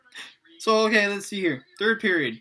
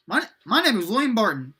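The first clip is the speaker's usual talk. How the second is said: raised, louder introduction; lightly voiced high-pitched boy; high boy's voice; 5 semitones higher, 5 dB louder than usual. A narrator's reading. William introduces himself to the group, in a raised voice.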